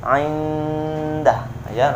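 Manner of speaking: indah with the n hummed long; correct nasal hold. Shown as a demonstration. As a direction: hum into the d of indah. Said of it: "Bagaimana cara bacanya?". (indah dengan dengung)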